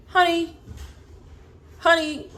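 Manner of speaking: high, mocking "honey, honey"; sassy honey. Real, not acted. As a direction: put them in their place with a sing-song high honey. Mocking the commenters.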